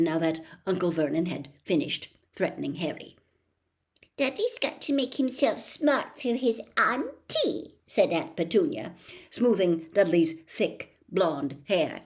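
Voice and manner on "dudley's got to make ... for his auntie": higher, fussing mum voice; doting mother. A narrator's reading. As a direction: coo over the boy. Petunia doting on Dudley.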